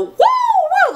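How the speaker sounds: high-pitched whooping; playful high "woo" whoops; mock fight noises. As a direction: whoop it like a cartoon scuffle. Imitates a scrappy fight.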